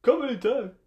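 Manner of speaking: talking into a cup; muffled, echoing cup voice. Pretend. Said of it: "Try talking into a cup".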